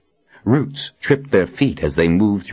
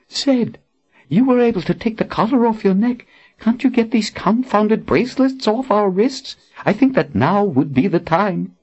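put-on higher, urgent young woman; impatient woman. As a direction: press him impatiently. Anne demands.

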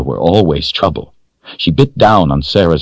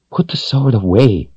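scolding; 6 semitones higher than usual. A narrator's reading. Woman scolding the man for frightening the girl, a firm scold. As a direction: tell him off without raising the voice. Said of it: "the woman scolded".

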